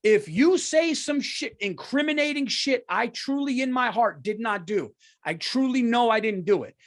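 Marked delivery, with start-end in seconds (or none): 0.0-4.4 can't hold it in; heated outburst; worked up. Real, not acted. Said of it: "I can't even fucking stop".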